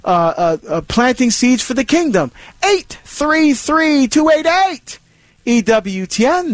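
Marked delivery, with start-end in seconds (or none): 2.6-6.5 slow, high, spelled-out phone number; radio host reading the call-in line slowly. Shown as a demonstration. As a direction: read the number out slowly for callers. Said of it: "giving call-in number".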